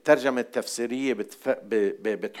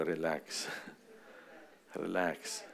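soft relax; 6 semitones lower, 12 dB quieter than usual. Shown as a demonstration. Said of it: "طلع اللي على جنبك قول له".

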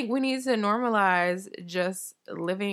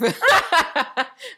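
high burst of real laughter; big laugh; 8 semitones higher, 5 dB louder than usual. Real, not acted. Laughing at the friend crying over Shrek. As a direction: crack up loud and high.

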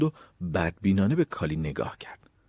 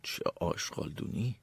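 very soft grumble; barely voiced, private disgust; under the breath; 2 semitones lower, 12 dB quieter than usual. A narrator's reading. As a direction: mutter it to yourself. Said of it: "زیر لب گفت".

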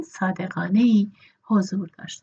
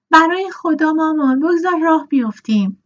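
louder, higher impatient cry; impatient shout; 8 semitones higher, 6 dB louder than usual. A narrator's reading. Hetty calling out to her mother to leave, impatient. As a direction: urge mother to get going now.

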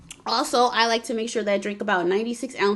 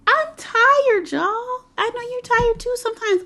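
pitched way up, whining; high whiny complaint; 13 semitones higher than usual. Acted. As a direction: whine it high about being tired. Exaggerated after-work tiredness.

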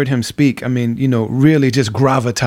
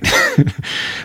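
short airy laugh. Real, not acted. Laughing at his own story.

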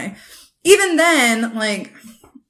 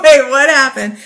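laughing, higher and louder mock confusion; 3 semitones higher, 7 dB louder than usual. Retelling her reaction to Alex's texts, laughing. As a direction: blurt it out through laughter. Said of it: "And I was like".

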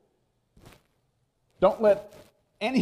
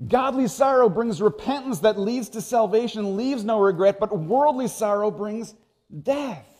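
raised, louder scripture proclamation; preaching at full force; 6 semitones higher, 4 dB louder than usual. Acted. Preacher to congregation, reading the verse with force.

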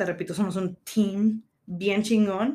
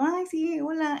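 shy greeting; 8 semitones higher than usual. Retold or imitated. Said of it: "Y yo".

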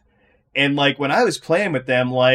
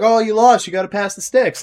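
put-on taunting older brother; 8 semitones higher, 3 dB louder than usual. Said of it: "be like".

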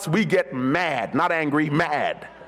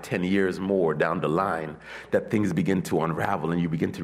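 lower, softer, intimate sermon voice; quiet preaching; 13 semitones lower, 5 dB quieter than usual. Acted. Preacher softly recounting closed doors to the congregation.

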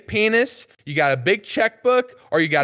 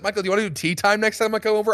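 usual speaking voice

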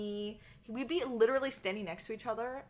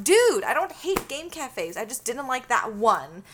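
exasperated protest; 3 semitones higher, 7 dB louder than usual. Retold or imitated. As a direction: push back in a raised, exasperated voice. Acts out her side of quarrel.

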